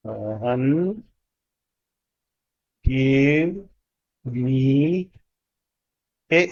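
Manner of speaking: very slow, a pause after each word; word by word dictation. Shown as a demonstration. Teacher dictating the corrected sentence, word by word. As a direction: let them write every word. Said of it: "ये बनेगा".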